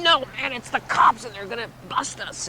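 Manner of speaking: high urgent voice, softening at the end; acted panic. Friend insisting police will bust them, high-voiced panic. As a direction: insist on the danger, pitched high.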